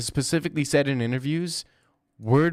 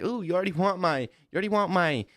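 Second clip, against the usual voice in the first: higher-pitched, hammed-up coy surprise; mock flattered surprise; 6 semitones higher than usual. Man at a bar answering a woman he just met, mock flattered surprise. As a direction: act taken aback by her eagerness.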